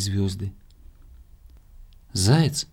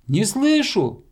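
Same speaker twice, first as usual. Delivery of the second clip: high-pitched distant call; 9 semitones higher, slower than usual. A narrator's reading. Hedgehog unable to hear hare, high calling voice. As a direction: call up, straining to be heard.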